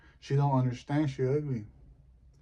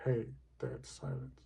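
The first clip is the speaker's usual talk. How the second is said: hushed hey; 10 dB quieter than usual. Shown as a demonstration. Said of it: "I started that trend".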